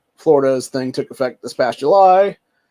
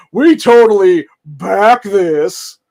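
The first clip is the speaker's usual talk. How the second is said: loud, slow mocking impression of the NRA saying it backs the bill; hammed-up sarcastic backer; 5 semitones higher, 11 dB louder, much slower than usual. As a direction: drag it out like a smug lobbyist. Mocking the NRA's last-minute stance.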